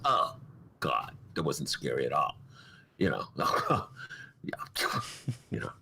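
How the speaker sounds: low, dismissive mock bravado; unimpressed tough guy. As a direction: play the guy who never gets scared. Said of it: "guys who are, like, not scared at movies".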